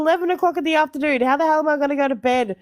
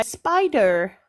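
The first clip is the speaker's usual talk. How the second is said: spider with a hard American R ending; put-on American spider, final R sounded; American accent; 5 semitones lower than usual. Shown as a demonstration. Australian showing her attempt at spider, American accent. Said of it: "in an American accent, I would have to say".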